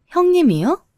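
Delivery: high-pitched mocking question; sneering, incredulous echo; scornful disbelief. A narrator's reading. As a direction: jump up high in scoffing disbelief. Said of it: "그러면서 다시 비웃더군요".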